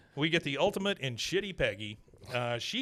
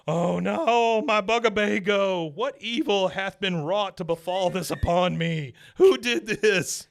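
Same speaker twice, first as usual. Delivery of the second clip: higher, louder theatrical wail, slow at the end; hammed-up Dale lament; 7 semitones higher, 8 dB louder, slower than usual. Retelling Dale finding his wrecked van, theatrical wail. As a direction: ham up the outraged despair. Dale's dramatic lament, retold.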